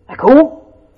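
high, loud, thrilled question; light up with delight; excited. Real, not acted. Preacher to congregation, high and thrilled. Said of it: "I got excited about that".

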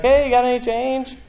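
begging man. Acted. Beggar asking temple passersby for money, pleading. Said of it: "imagine he's sitting there and he's like".

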